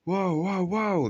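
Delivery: high, drawn-out calls to stop; calling whoa. A narrator's reading. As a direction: call out to halt the engines. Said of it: "they cried again".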